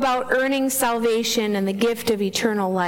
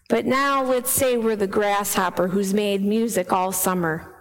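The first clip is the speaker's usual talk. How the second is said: ordinary talk, nothing marked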